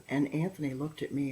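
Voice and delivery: usual speaking voice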